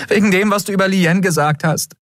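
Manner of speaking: high, urgent outpouring; impassioned high-pitched protest; desperate confession. A narrator's reading. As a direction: blurt it out, pitched high and pleading. Heated confession of love, high voice.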